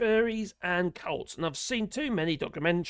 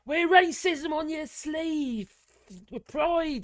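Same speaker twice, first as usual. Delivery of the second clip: high, slow, drawn-out proclamation; hammed-up rallying slogan; mock proud declaration; 9 semitones higher, 4 dB louder, much slower than usual. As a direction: proclaim it grandly, pitched up and stretched. Impersonates a proud Bannon supporter quote.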